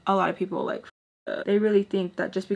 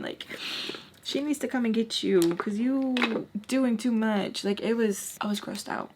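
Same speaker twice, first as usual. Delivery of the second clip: ordinary talk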